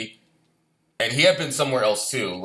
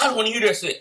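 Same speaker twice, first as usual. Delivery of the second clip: retold outburst; 10 semitones higher, faster than usual. Davey refusing to hear the sergeant, high mock outburst. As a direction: toss the line up high, half amused.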